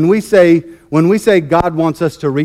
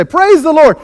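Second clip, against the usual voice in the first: much higher, louder burst of praise; joyful praise; 9 semitones higher, 5 dB louder than usual. Retold or imitated. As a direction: lift the words up to greet the day. Old lady greets each morning.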